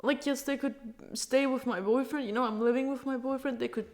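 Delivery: choked-up talk about who keeps the kittens; tearful. Real, not acted. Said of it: "I haven't tasted a tear in such a long time".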